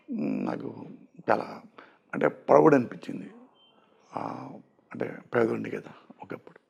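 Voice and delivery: quietly moved, remembering being poor; choked up. Real, not acted. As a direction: voice sinking low and soft with emotion. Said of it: "emotional కూడా అవుతున్నారు".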